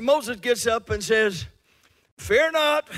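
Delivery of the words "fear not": high, loud preacher's cry; a preacher shouting fear not to the crowd; apostolic preacher. Shown as a demonstration. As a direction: proclaim it like a fired-up apostolic preacher. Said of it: "Doesn't that sound like a preacher".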